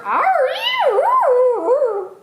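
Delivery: high, loud howling wail; dog wail. A narrator's reading. The dog howls at the preacher mid-sermon, high and loud. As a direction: let out a long dog's wail. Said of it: "wailed Winn-Dixie".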